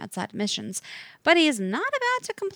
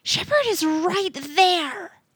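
through clenched teeth; 11 semitones higher, 4 dB louder, slower than usual. Acted. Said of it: "through clenched teeth".

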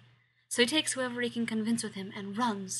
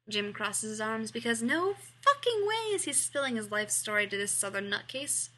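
usual speaking voice

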